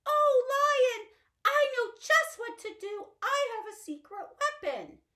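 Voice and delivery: very high, loud, fast little mouse; squeaky mouse voice. Acted. Little Mouse running to rescue Lion, high and quick. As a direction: chirp it out like an excited tiny mouse.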